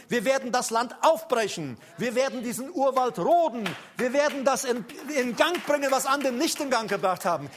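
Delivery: loud, driving battle declaration; rallying cry. Acted. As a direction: proclaim it like a war cry.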